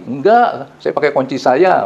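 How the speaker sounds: normal talking voice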